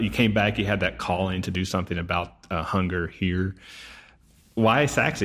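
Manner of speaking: ordinary delivery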